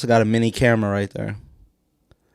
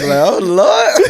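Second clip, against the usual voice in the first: high, loud laughing lament; raised, hammed-up exclamation; mock dismay through laughter; 7 semitones higher, 7 dB louder than usual. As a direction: play a shocked mourner, cracking up. Mock mourners at open casket.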